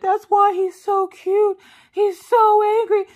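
put-on very high, drawn-out baby-talk squeal; mock gushing. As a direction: imitate someone gushing over a puppy. Husband gushing over the puppy.

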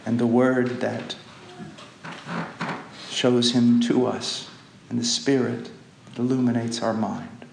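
soft, low closing prayer; hushed reverent prayer. Acted. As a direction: pray quietly to the Father.

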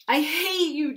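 high, loud yell; a high, loud yell at his stomach. Acted. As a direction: yell it at your own stomach. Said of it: "Jim yelled at his stomach".

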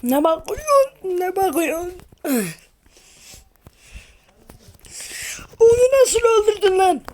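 high, crying cartoon character shout; turkish cartoon dub. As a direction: wail it like a dubbed cartoon. (yüksek, ağlamaklı çizgi film bağırışı)

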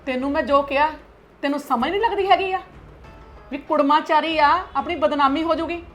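high-pitched angry scolding; scolding mother. Acted. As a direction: scold her for talking nonsense.

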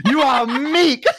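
slow, high, hammed-up pronouncement; mock old-time insult. Teasing the seventeenth century insult, mock-grand voice. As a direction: declare it like an archaic insult, laughing.

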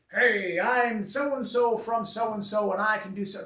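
mock a fake radio announcer introducing himself; put-on announcer voice, projected. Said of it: "that radio announcer voice kind of thing".

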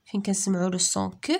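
normal talking voice

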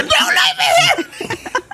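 drawn-out, very high panicked wail; mock panic. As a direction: wail like a terrified kid, hammed up. Imitates panicked son being left.

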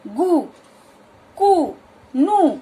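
गु, कु, नु each said alone as a short clipped syllable. Shown as a demonstration. Teacher sounding out syllables with a short u. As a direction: snap out गु, कु, नु with a very brief उ. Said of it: "वैसा ही दर्शा रहा है उच्चारण में".

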